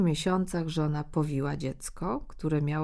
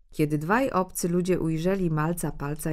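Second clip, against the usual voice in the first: ordinary delivery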